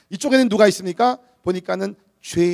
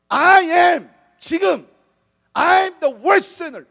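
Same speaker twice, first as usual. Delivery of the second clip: very slow, higher, every word of I am the worst sinner weighted; present tense emphasis; 8 semitones higher, 6 dB louder, much slower than usual. Shown as a demonstration. Preacher stressing Paul's present tense confession, slow and emphatic. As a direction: lean hard on I am as right now. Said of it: "현재용을 써, 영어로 썼다는 거예요".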